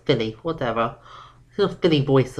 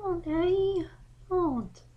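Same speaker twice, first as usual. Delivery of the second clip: high, small plea to Daddy; pleading filly; 13 semitones higher, 6 dB quieter than usual. Acted. Daughter begs her dad to stop, small and pleading. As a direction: beg him softly not to.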